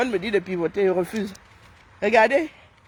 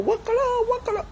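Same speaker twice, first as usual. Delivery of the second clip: excited squeal; 13 semitones higher, 3 dB quieter, faster than usual. Real, not acted. Exclamation de joie.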